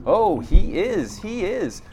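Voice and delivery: high, slow gush of devotion; gushing excitedly. A narrator's reading. Priest spider gushing eagerly about the Spider Lord. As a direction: swoon over the great Spider Lord.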